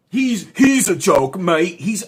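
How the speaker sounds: loud, high mock Aussie accent; hammed-up Australian mate. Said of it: "Where are you from, Australia?".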